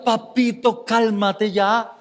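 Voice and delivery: slow, mock-gentle plea to a child; slow, coaxing mock plea to a rowdy kid. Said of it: "si usted le habla así? No se va a calmar".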